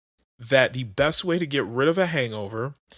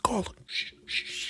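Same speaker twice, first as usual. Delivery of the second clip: soft shushing whisper; new man whispering to the wife to hush; 6 semitones higher, 11 dB quieter than usual. Retold or imitated. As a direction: hush her so he stays calm. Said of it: "Dale's just like".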